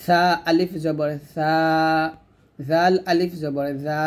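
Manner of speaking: slow spelling, সা and জা held long; tongue tip on upper teeth, stretch সা, জা; tongue-tip madd. Shown as a demonstration. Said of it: "জিহ্বার আগা উপরে দাঁতের আগার সঙ্গে".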